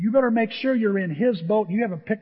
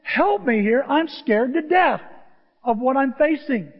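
higher, louder pleading cry; frightened plea; 6 semitones higher, 6 dB louder than usual. Acted. A believer admits fear to the Lord, a louder scared plea. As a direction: beg for help, scared. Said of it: "admit we're afraid and say".

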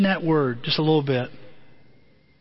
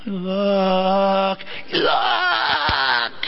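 mimicking baby. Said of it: "And she's like".

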